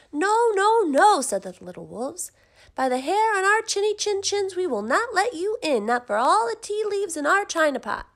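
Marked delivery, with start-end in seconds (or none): 0.1-1.2 wolves cry out high and loud; high loud refusal. A narrator's reading. Little wolves refusing the pig at the door, high loud cry. 2.7-8.1 high, loud, squeaky defiance; defiant little wolves. A narrator's reading. Wolves defy the big bad pig, high and loud.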